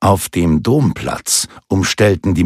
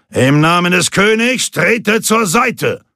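ringing command, pitched well above normal; commanding call; 10 semitones higher than usual. A narrator's reading. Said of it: "befahl Thierry".

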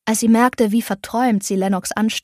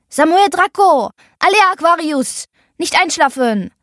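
calling out; 7 semitones higher, 5 dB louder than usual. Acted. Calling the crew to wake.

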